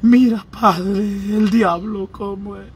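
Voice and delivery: hammed-up weepy plea, slow; a believer mock-wailing to God over a rotten tooth; mock crying prayer. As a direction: fake sob over a lost tooth.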